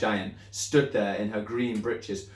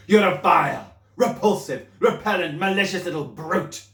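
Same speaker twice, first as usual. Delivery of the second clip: higher, louder, spat-out insults; shouting; 8 semitones higher, 8 dB louder than usual. Acted. Headmistress shouting insults at the pupil. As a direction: hurl each insult like a blow. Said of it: "the Trunchbull was shouting".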